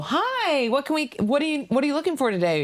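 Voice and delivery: very high, sing-song clerk greeting; perky store clerk. Retold or imitated. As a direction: chirp it like an over-eager salesgirl.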